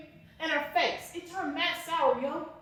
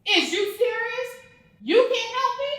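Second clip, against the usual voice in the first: rising outrage; 6 semitones higher, 4 dB louder than usual. Acted. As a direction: pitch climbing, louder, disbelieving. Angry at housing office clerk.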